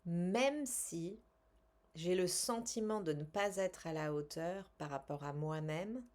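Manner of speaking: calm, measured guided-relaxation voice; soothing self-help guide voice; guided tapping session. Acted. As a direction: lead them gently through the tapping.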